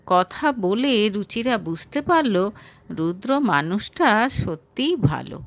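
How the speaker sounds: ordinary delivery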